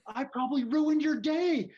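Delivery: high, loud re-enacted shout; yelled admission. Driver owning up to the angry man, yelling. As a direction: shout contritely as if in a fight. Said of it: "we're still kinda yelling".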